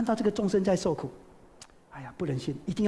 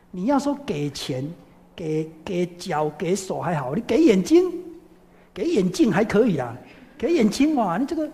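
incredulous exclamation; 4 dB louder than usual. Retold or imitated. Sariputra weighs giving his eyes.

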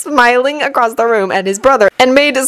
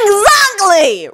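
Ron's curt growl, higher than the narration; 12 semitones higher, slower than usual. Acted. Ron ending the argument with Hermione, curt growl. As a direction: snap it out with finality. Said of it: "Ron growled".

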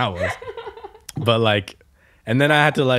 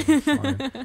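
woozy reassurance. Retold or imitated. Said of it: "I'm like".

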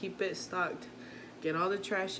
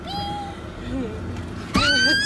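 laughing shriek; 10 dB louder than usual. Real, not acted. Son teases her about recording.

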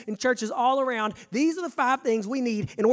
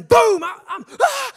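startled scream; 14 semitones higher, 12 dB louder, slower than usual. Retold or imitated. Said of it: "I'm screaming".

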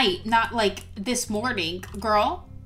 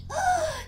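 sharp high gasp; sudden high intake of breath; 4 dB quieter than usual. Real, not acted. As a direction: suck in a shocked breath. Reacting to smelly toddler.

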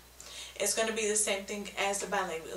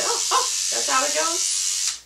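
imitating a kid; 7 semitones higher, 8 dB louder than usual. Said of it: "they can say".

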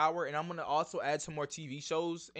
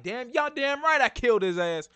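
raised, louder mock satisfaction; Paz; 7 semitones higher, 6 dB louder than usual. Host imitating Paz owning up to killing, mock satisfied. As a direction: boast it with hammed-up satisfaction. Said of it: "she looks satisfied".

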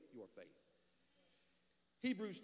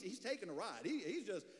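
ordinary delivery

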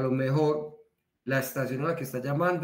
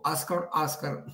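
Oscar with a gringo accent; 2 semitones higher, slower than usual. Shown as a demonstration. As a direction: say Oscar, Oscar slowly, the American English way. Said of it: "dizque muy gringo". (Oscar dicho lento con acento gringo)

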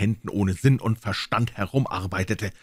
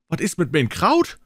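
higher, louder shriek; shrieking wives; 8 semitones higher, 4 dB louder than usual. Acted. Women shrieking about their sauerkraut. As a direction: cry out like a housewife. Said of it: "schrien all die Frauen".

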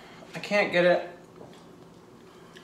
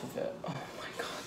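whispering; 16 dB quieter, faster than usual. Real, not acted. Whispering in awe at batter.

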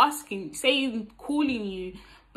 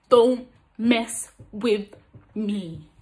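slow, word-by-word warning, loud at first; emphatic start, dropping on the last word; firm warning; 7 dB louder, much slower than usual. Acted. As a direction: space out every word to warn him off. Repeats the one-liner warning.

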